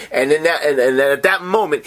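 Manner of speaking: usual speaking voice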